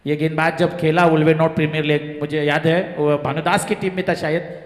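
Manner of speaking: ordinary delivery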